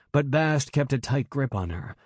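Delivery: ordinary delivery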